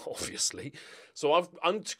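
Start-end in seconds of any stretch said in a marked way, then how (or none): 0.0-0.7 breathy laughing aside, hushed; laughing whisper. Real, not acted. YouTuber whispering to viewers through a laugh. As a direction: let the word slip out through a laugh.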